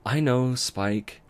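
slow, gentle and kind; kindly. Acted. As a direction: let him down kindly. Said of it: "Twilight smiled kindly".

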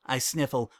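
plain reading voice

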